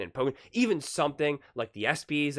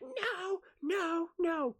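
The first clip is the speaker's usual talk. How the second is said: mock wail; 3 dB quieter, much slower than usual. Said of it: "they're like".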